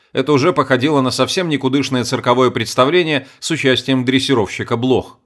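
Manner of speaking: ordinary delivery, nothing marked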